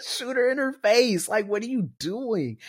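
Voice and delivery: high-pitched exasperated retold yelling; worked-up viewer yelling at the screen; retold scream. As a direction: re-enact pleading with the TV. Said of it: "I literally screamed at the T V".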